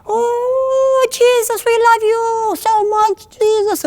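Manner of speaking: imitating a lady praying in a very high voice; very high prayer voice. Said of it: "she had a higher pitch".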